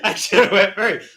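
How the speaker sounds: loud, high words through laughter; laughing. Real, not acted. Admitting they cannot top that, loud laughing. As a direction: laugh while saying it.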